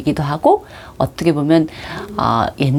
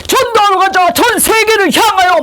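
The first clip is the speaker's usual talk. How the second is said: high, hammed-up battle cry; zealous rallying cry; 4 dB louder than usual. Student calling everyone out to evangelise the world, overzealous shout. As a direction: rouse the troops with mock fervour.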